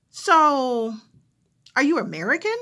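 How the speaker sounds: a caller getting too personal; curious caller. Retold or imitated. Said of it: "the person who's calling".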